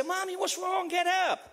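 put-on high-pitched child's worried plea; imitated child at the bedside; child calling mom. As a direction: pitch it up like a child rousing mother. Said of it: "Grant will come into the room and he'll say".

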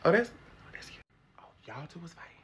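hushed whisper over a fight video; whispered shock. Acted. Teacher watching the fight video, whispering in shock.

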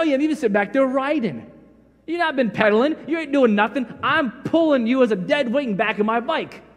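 usual speaking voice

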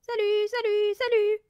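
high, ringing parrot call; loud clear parrot. A narrator's reading. Unseen parrot heard from the dining room, loud and clear. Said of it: "une voix forte et claire retentit".

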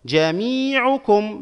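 very slowly stretch "مي" in "جميعكم"; drawn-out long vowel. Shown as a demonstration. Teacher analysing the word for students, drawn-out long vowel. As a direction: hold a high long i in "جميعكم". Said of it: "مد مع الممدود".

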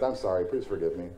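ordinary talk

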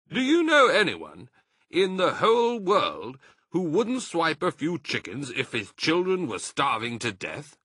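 high, loud indignant question; indignant fox. A narrator's reading. Mr Fox defending the stealing, indignant. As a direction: argue it with fire.

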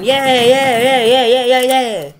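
mocking cheer. Mocking cheer at terrorists winning.

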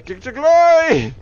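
loud, drawn-out cry to glory; battle cry. Pretend. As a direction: shout it high and stretch it out. Battle cry during play.

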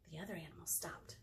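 quick storytelling whisper; very soft, breath-only aside; hushed narration. A narrator's reading. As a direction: drop to a whisper for suspense. Hushed narration after a dramatic stop.

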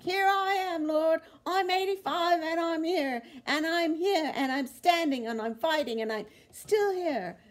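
high, very loud declaration, held at full force; Australian preacher's rallying cry. Retold or imitated. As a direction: cry it out defiantly, imitating a fired-up preacher. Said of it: "she was like".

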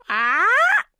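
loud, high-pitched parrot squawk. A narrator's reading. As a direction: screech out like a parrot. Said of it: "squawked the parrot".

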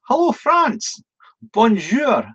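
bright sing-song hello to France; high cheery greeting. Real, not acted. Greeting a viewer from France, high and cheery. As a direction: call it out high and friendly.

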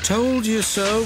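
high, slow mournful I-told-you-so; sad. A narrator's reading. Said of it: "said Caroline sadly".

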